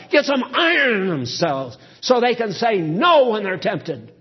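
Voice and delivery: building from steady to loud, high-pitched exhortation; fiery preaching. Acted. Preaching rises to full force.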